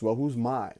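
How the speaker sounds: normal talking voice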